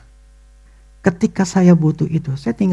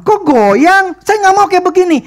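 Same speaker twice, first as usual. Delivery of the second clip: irritated complaint; 11 semitones higher, 4 dB louder than usual. Retold or imitated. Homeowner complains about wobbly tap.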